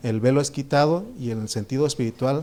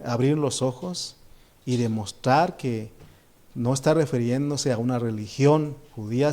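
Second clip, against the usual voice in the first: ordinary talk, nothing marked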